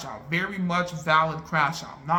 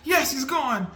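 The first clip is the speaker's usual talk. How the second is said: higher hopeful cheer, rising more; 9 semitones higher than usual. Retold or imitated. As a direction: cheer again as he seems gone. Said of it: "I'm going back and forth".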